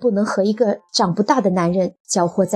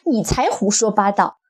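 higher, quick-fire angry rebuttal; indignant retort; 7 semitones higher, faster than usual. A narrator's reading. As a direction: throw the accusation back, pitch jumping up. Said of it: "女子愤愤地反驳道".

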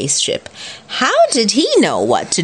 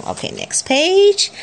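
ordinary delivery

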